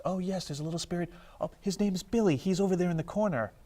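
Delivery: chirpy tour guide. Retold or imitated. Homeowner telling investigators about a little spirit, chirpy imitation. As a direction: imitate an eager homeowner showing visitors round.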